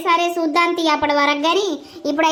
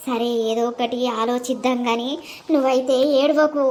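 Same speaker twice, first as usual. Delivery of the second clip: grandmother's voice; 3 dB quieter than usual. Acted. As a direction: soothe the crying girl as her granny. Grandmother soothes, worries.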